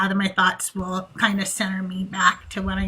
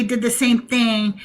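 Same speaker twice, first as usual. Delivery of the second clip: ordinary delivery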